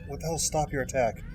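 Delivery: ordinary delivery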